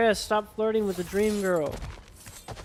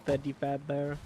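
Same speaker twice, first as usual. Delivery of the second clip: ordinary talk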